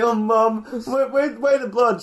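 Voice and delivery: high, loud, halting protest to Mum; mock distressed kid. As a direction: play the upset son, stumbling over words. Kevin complains to his mum.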